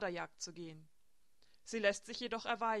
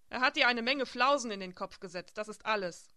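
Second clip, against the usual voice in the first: chiding; 2 semitones higher, 9 dB louder than usual. A narrator's reading. Bertha chides Elsbeth.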